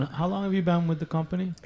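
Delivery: ordinary talk, nothing marked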